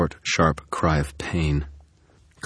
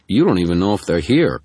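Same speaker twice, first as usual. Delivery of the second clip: louder, indignant protest; 4 semitones higher, 7 dB louder than usual. Acted. Carmichael objecting to Murphy, a louder indignant protest. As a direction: push back at the order. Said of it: "Carmichael protested".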